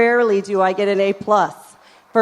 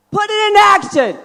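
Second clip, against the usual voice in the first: loud urging; 8 semitones higher, 6 dB louder, faster than usual. Real, not acted. Urging audience on stage.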